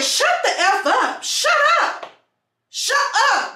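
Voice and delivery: high, sharp retold yell; yelling shut up. Narrator yelling at the driver to shut up. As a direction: snap at her to shut up.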